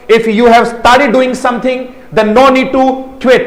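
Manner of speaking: very slow, word by word; a teacher dictating slowly; slow dictation. Shown as a demonstration. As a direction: spell it out slowly for the class. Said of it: "teacher dictates English sentence".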